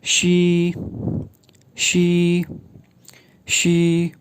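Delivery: शी, light and hissed; शी like the Mandarin syllable xi; pinyin xi. Shown as a demonstration. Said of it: "एक्स की sound".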